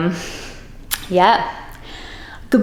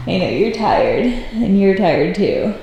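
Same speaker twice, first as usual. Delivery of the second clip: ordinary talk, nothing marked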